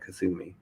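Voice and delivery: Kuthumi softly, with TH as in thin; guessed pronunciation. Shown as a demonstration. Host repeating the spelled-out name, Kuthumi quietly as koo-THOO-mee. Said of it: "I would imagine that's how it's pronounced".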